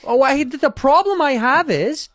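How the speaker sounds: slow, high, swooping lecture; high-pitched, laboured pronouncement; Jordan Peterson. Acted. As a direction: drag the words out, pitch climbing and falling. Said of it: "his Jordan Peterson impression".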